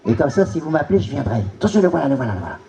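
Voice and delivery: ordinary delivery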